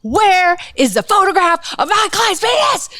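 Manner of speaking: hammed-up defense attorney outrage; mock courtroom yell. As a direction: shout it like a lawyer pounding the table. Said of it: "banging on the counsel table and yelling".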